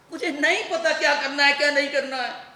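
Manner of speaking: exasperated, loud reply; angry outburst. Acted. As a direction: throw it back at her heatedly. Son snaps back at mother.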